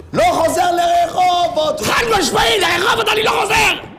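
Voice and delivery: high-pitched shouted refusal; adamant refusal. Real, not acted. Refusing to return to Rehovot, high adamant shout.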